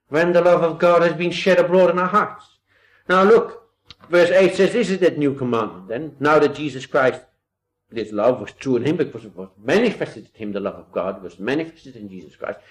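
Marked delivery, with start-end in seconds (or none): 0.1-7.2 louder, raised preacher's voice; fervent preaching, louder and raised. Acted. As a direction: preach it out to the congregation. Preaching at full force.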